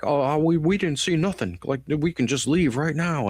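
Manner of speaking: high-pitched, cowardly stammer; mock wimpy pleading. O'Neill wanting to just leave, in mock cowardly pleading. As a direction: imitate a scared soldier begging off. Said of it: "just a real pussy".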